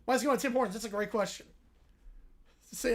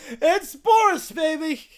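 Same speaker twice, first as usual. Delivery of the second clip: excited; 3 semitones higher, 6 dB louder, slower than usual. Real, not acted. Gleeful repeat of catchphrase.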